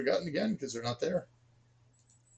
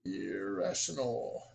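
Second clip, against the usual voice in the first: slow; 5 semitones lower, much slower than usual. Shown as a demonstration. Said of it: "So we're out of rations".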